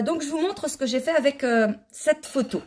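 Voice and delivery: usual speaking voice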